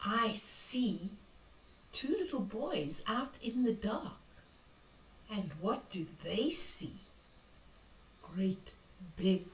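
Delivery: hushed, spooky picture-book reading; reading to children. A narrator's reading. Granny reading a spooky book to children, hushed. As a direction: draw the kids into the mystery.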